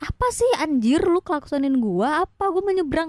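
high, put-out voice of an offended rider; imitated indignation, pitch climbing high; indignant retort. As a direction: protest it as if wronged.

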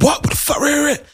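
retold robber barking, hammed up. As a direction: imitate a robber barking orders. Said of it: "He was barking".

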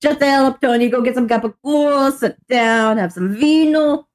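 bossy New Jersey goombah; Jersey Italian accent. Acted. Italian Jersey guy impression.

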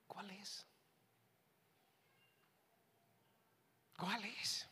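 whispered, probing question; hushed questioning. Real, not acted. Preacher asks congregation for their perspective, in a pointed whisper. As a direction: whisper it to make them think.

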